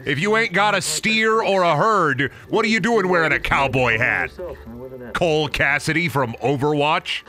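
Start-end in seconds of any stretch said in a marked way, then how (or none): none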